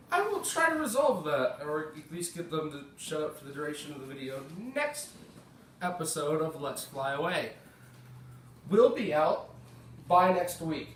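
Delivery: ordinary delivery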